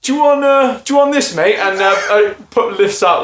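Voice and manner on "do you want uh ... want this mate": raised, lightly voiced offer from the ex-lorry-driver neighbour; a hint of a burly neighbour; neighbour's offer. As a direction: offer the hammer, pitched up and casual. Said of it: "comes out and goes".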